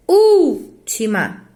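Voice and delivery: "última" slowly, ÚL long and punched, then "tima"; stress on first syllable. Shown as a demonstration. Teacher demonstrating a proparoxytone, última with heavy ÚL. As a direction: hit the ÚL of última, pause, let the rest drop. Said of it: "Porque é uma proparoxítona".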